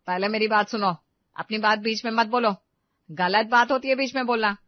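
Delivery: loud, stern telling-off; mother-in-law laying down the rules; scolding. Acted. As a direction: cut in and reprimand firmly. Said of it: "कभी न डांट का मजा चखा था".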